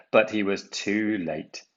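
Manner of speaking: ordinary delivery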